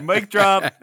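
ordinary talk

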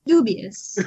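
"Dubious" said as one deliberate word, first syllable stressed; repeat on request. Real, not acted. Friend asked to hear the word, obliging repeat of "Dubious". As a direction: say "Dubious" by itself for the listener. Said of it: "Say dubious again".

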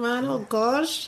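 light Bajan lilt on oh gosh; Bajan accent. Pretend. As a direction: drop into a playful Bajan accent. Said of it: "Let me talk in my Bajan accent".

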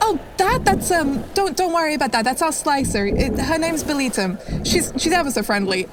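a cheerful boat woman downplaying the beast; fisherwoman character.

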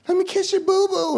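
high sweet mock-parent voice; caring parent. Parent comforting a hurt kid, high cooing voice. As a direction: coo it like a doting parent. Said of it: "they'd be like".

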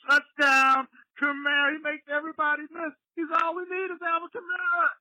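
mock broadcaster hyping the touchdown; touchdown call. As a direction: call it like the stadium just erupted.

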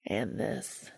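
Castiel's low, seductive rasp; deep rough voice. A narrator's reading. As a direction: let it sink deep and rough like sandpaper. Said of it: "Castiel's voice was deep and rough like sandpaper".

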